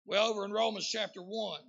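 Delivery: loud, emphatic sermon delivery; full-force preaching. Real, not acted.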